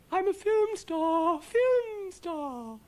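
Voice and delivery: very high, loud and overjoyed; thrilled squeal. A narrator's reading. The cow celebrates becoming a film star, shrieking high with joy. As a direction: squeal with delight like a diva cow. Ermentrude singing out her joy.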